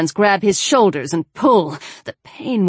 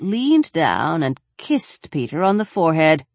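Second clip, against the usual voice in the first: plain reading voice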